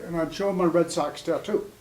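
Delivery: usual speaking voice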